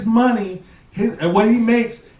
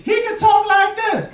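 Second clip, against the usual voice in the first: much higher, slower voice; high voice demo; 12 semitones higher than usual. Shown as a demonstration. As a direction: squeak it up to show the contrast. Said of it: "no matter what your man sound like normally".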